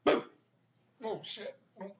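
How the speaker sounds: usual speaking voice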